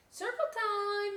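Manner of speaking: high, sing-song teacher call. Acted. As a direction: call the class over brightly. Teacher calling.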